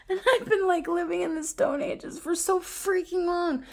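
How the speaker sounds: very high, giddy disbelief; incredulous excitement. Real, not acted. Vlogger amazed she missed this invention, excitedly incredulous. Astonished such gadgets have existed.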